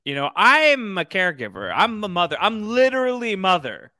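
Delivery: high, emphatic mock indignation; clapback. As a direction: act out an indignant comeback. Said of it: "Mother once again with the, with the, the clapbacks".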